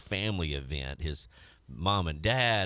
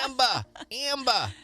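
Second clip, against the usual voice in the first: put-on New York accent. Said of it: "this New York City accent".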